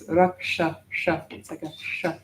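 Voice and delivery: "raksha" with a curled-back sh, then "sha" alone; retroflex S. Shown as a demonstration. Teacher explaining the dot under the S, retroflex "sha". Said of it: "makes it a retroflexive S".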